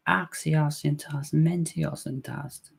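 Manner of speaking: Axios intas, mentios intas, spoken slow and soft; slow soft High Valyrian chant of Axios intas; High Valyrian recital. Shown as a demonstration. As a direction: intone the High Valyrian words slowly, as a recital. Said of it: "of High Valyrian that I know".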